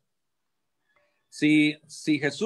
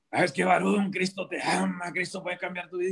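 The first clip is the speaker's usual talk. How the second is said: mock prison preacher; 5 semitones higher than usual. Ex-convict mimics a prison preacher pitching Christ to him, mock preachy voice. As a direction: poke fun at the pushy street preacher style. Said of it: "y empiezas a predicar así".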